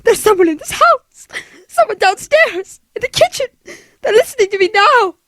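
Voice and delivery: tearful, urgent fear; frightened. Acted. Invalid telling nurse of an intruder, frightened. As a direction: insist in mounting panic.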